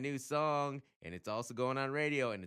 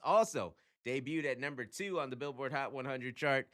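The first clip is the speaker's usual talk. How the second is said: ordinary talk, nothing marked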